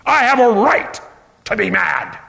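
self-righteous claim. Acted. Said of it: "old sin nature that demands its rights".

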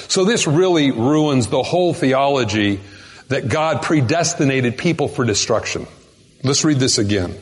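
ordinary talk

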